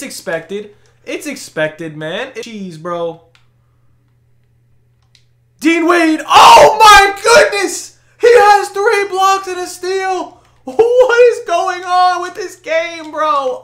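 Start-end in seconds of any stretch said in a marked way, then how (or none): 5.6-13.6 high, loud, drawn-out cries of disbelief; hyped screaming. Real, not acted. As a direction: scream in amazement at the play. Amazed at another block.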